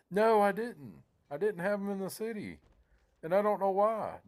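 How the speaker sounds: very high, loud self-mocking protest; mock exasperation. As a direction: wail at your own blunder, half amused. Laughing at his own blunder, mock exasperation.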